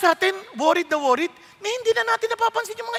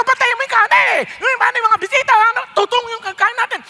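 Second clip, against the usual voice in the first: higher, louder furious yelling; angry shout; 5 semitones higher, 3 dB louder than usual. Retold or imitated. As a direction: yell at the cooks about the rice. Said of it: "galit na galit, sumisigaw". (galit na galit na sigaw ng may-bahay)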